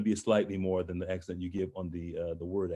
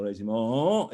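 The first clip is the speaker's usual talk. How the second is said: "régiment" swooping up on a held last syllable; rising RÉGIMENT; slower than usual. Shown as a demonstration. Coach shows the musical accent, "régiment" rising to the top. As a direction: carry the end of "régiment" all the way up. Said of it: "it goes all the way up".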